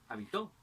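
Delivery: habitó said ha-bi-TÓ; stress on last syllable. Shown as a demonstration. Teacher giving accent examples, habitó stressed on TÓ. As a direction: land habitó on its final syllable. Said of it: "el acento puede variar".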